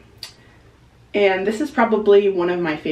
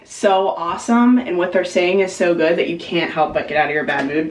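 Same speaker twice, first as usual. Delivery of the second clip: usual speaking voice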